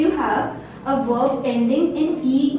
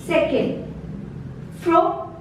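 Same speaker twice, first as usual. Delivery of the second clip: ordinary talk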